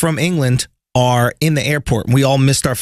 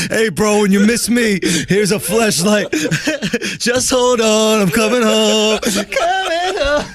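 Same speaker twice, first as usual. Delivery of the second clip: mock crooning singer voice, higher and drawn out, laughing; 6 semitones higher, slower than usual. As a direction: croon it like a slow R&B love message, breaking into laughter. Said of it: "Is that in your [name] care package?".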